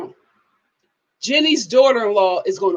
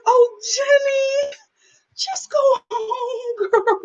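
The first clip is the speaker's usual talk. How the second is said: mock tender; 12 semitones higher, slower than usual. Comforting the crying woman.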